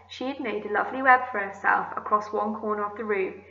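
usual speaking voice

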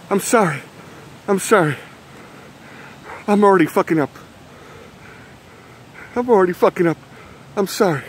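scared apology. Acted. Parody of scared horror-film apology.

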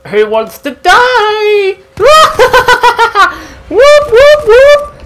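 high, loud sung taunt and whoops; manic battle cry. Pretend. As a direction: whoop like a maniac. Battle frenzy, singing and laughing.

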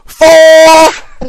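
high, loud mock golf call; golf shout. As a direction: call it out across the course.

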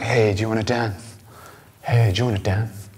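mock a girl asking without interest; bored, unimpressed ask. Imitating an unimpressed girl asking him to dance. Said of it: "It wasn't like".

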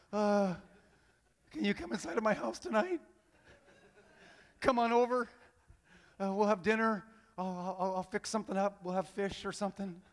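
lightly acted awkward, halting invite; hesitant invitation. Zacchaeus teetering on the branch inviting Jesus, mildly hesitant.

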